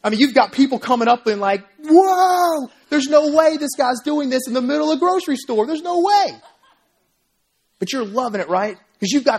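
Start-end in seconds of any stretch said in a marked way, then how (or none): 1.8-6.4 high, loud, laughing disbelief; amazed onlooker. Retold or imitated. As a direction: gasp it out like a stunned bystander. Amazed onlooker in imagined crowd.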